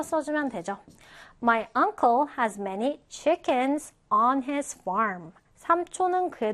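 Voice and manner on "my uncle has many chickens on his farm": slow, carefully spaced English example; slow model reading. Shown as a demonstration. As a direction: read it slowly for learners to follow. Teacher models example sentence for learners.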